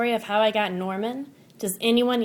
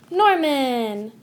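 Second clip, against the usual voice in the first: usual speaking voice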